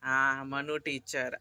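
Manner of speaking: slow, drawn-out name; slow model for child. Shown as a demonstration. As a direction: spell it out slowly for the child. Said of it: "जरा मोठ्याने बोल रे बाळा". (मुलाला नाव सांगताना हळू, ताणून)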